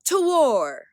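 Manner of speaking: high, loud battle cry. A narrator's reading. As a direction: roar them into war. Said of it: "they cried".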